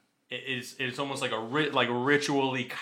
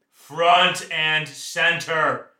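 drawn-out loud command; drill sergeant; 2 semitones higher, 6 dB louder, much slower than usual. Pretend. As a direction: stretch out the order slowly. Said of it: "like a drill sergeant".

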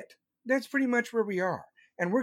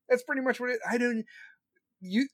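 ordinary talk

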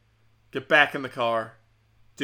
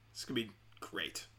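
breathless sarcastic aside, much softer; deadpan sarcasm; 5 semitones lower, 15 dB quieter than usual. Real, not acted. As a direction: mutter it like you know it won't be great. Sarcastic about having zero dollars.

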